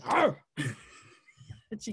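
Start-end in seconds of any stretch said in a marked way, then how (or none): 0.0-0.4 sudden high, loud mock "arr"; pouncing growl. Female tarantula grabbing the male, mock attacking snarl. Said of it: "then she goes".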